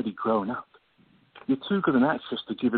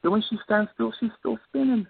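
much higher, sing-song ridicule; mocking jibe; 8 semitones higher than usual. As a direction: poke fun at the sister's frantic life. Mocking the scatterbrained sister.

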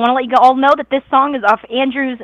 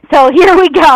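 laughing through the words, a bit louder; 4 semitones higher, 6 dB louder than usual. Real, not acted. Host laughing to her listeners on live radio, loud giggly delivery.

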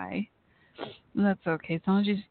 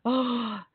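gasp; 3 semitones higher than usual. Real, not acted. Reacting to crash headline.

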